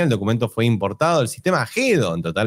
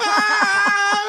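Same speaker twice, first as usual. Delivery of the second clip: high squeal. Retold or imitated. Penguin voice shriek.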